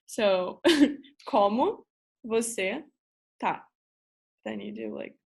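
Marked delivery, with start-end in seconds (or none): 1.3-3.6 "Como", "você", "tá" separated by pauses; word-by-word demonstration. Shown as a demonstration. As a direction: teach "Como você tá" one word at a time, each lifted. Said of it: "you can say".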